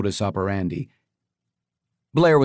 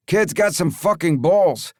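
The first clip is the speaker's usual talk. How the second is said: gruff henchman voice; 7 semitones higher than usual. Acted. Leo voiced.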